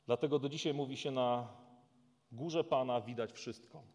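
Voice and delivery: solemn dramatic Bible reading; scripture reading. Acted. Preacher reading Genesis to the congregation, solemn. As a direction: read the story with weight. Reads angel's words and ending.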